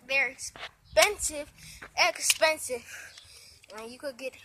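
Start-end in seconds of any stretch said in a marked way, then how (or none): 3.8-4.3 hushed warning whisper. Real, not acted. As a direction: drop to a whisper. Whispered aside about danger.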